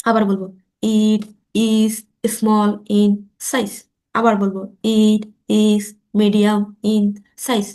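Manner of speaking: slow, word by word with long gaps; teacher modelling slow speech; slow practice pace. Shown as a demonstration. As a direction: drill each word slowly for learners. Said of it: "slow বলবো".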